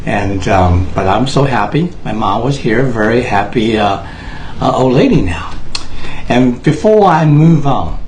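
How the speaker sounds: normal talking voice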